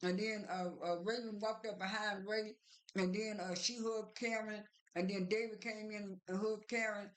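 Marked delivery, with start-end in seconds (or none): none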